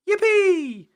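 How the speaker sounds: loud, very high-pitched squeal of joy; shrill, jubilant whoop; cheering. A narrator's reading. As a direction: cheer at the top of a little pig's voice. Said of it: "cheered Peppa".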